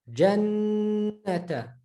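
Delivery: jannata with a long nasal nn; prolonged noon. Shown as a demonstration. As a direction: hum and hold the nn in jannata. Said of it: "is going to be prolonged".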